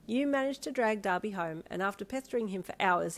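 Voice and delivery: plain reading voice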